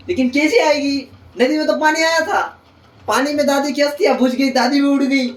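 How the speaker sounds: mock dramatic punchline. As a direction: build to a silly payoff. Punchline of mock ghost story.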